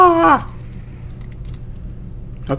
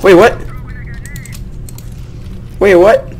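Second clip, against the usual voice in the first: surprised shout; 9 semitones higher than usual. Real, not acted. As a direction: blurt out in disbelief. Louder repeat of confusion.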